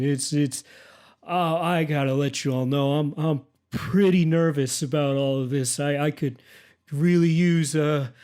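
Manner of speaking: nervous, rambling Prasvalian dude; Prasvalian accent. Acted. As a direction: ask for backup haltingly in a made-up Prasvalian accent. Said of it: "Oh, that's the Presvalian accent".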